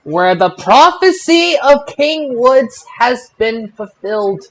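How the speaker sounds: ordinary delivery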